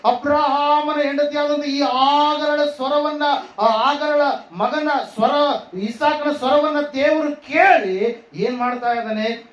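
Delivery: high scripture proclamation with stretched words; fervent preaching. Acted. Preacher voicing God's promise to Hagar, fervent preaching.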